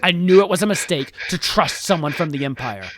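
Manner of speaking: loud, high exasperated tirade; groan, then rail bitterly; furious rant. Acted. King rages about trusting Empire people, furious.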